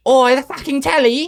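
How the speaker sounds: high, loud Cockney voice; British accent. Pretend. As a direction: do a hammy London geezer. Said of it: "to say British".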